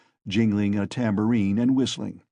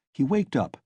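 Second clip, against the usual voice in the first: plain reading voice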